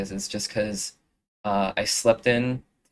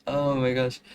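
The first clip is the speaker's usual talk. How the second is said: normal talking voice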